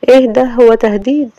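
wife rebuking the husband for banging on her door; indignant Sama. Acted. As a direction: snap back at the rude husband.

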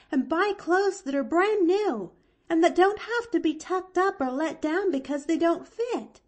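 put-on high, loud, gushing girl voice; delighted little girl, pitched high; excited young Pollyanna. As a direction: gush in a bright, high-pitched rush.

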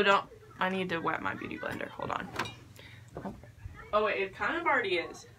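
usual speaking voice

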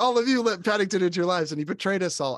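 high, loud mock indignation; accusing police guard. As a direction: denounce the neighbors like an outraged guard. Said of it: "the police guard".

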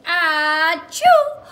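big, high, loud hammed-up sneeze; the cow's huge sneeze, played for the kids; mock sneeze. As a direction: blast out a pretend sneeze. Cow sneezes in story.

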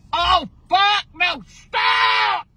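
high, loud frightened yell; panicked shout. Real, not acted. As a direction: scream at him to stop. Grandma panics as car taken.

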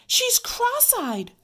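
high, loud, drawn-out exclamation; alarmed cry. A narrator's reading. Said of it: "cried Ramona".

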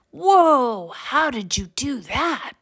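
put-on high, astonished boy; amazed gasp. A narrator's reading. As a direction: open on a high wowed exclamation, then ask in wonder. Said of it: "Max gasped".

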